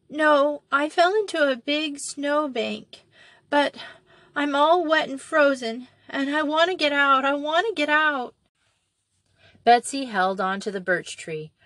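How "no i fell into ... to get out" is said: put-on high, tearful little girl; crying child. A narrator's reading. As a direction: sob and plead to get out. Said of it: "The child was crying and sobbing".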